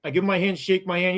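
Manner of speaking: ordinary talk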